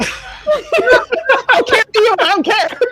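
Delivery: squeaky voice through laughter; can barely speak for laughing. Real, not acted. GM breaks down laughing.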